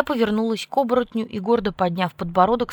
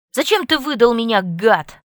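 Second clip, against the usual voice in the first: angry; 4 semitones higher, 8 dB louder than usual. A narrator's reading. Angry reproach to Jake.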